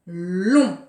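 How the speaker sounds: long said alone, low and loud; stressed syllable. Shown as a demonstration. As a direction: land hard on the syllable long. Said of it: "mettre une croix dans la syllabe". (long appuyé, grave et fort)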